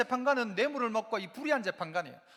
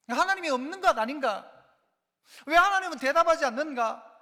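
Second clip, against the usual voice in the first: raised, louder voice, pleading for an answer; anguished complaint; 5 semitones higher, 6 dB louder than usual. Acted. Believer complaining that God won't answer prayer, anguished protest. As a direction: cry out in frustrated protest.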